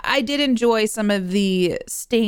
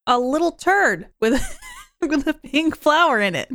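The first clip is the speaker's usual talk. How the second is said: amused, laughing; 5 semitones higher than usual. Real, not acted. Podcaster quoting her husband's description, amused and laughing. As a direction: laugh partway through, pitched up.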